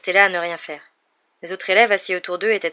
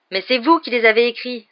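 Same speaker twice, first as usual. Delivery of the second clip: higher, louder protest; indignant; 5 semitones higher, 6 dB louder than usual. A narrator's reading. Pupil confronts the author about his own books, indignant. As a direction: throw it back at him, outraged. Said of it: "s'indigna Harry".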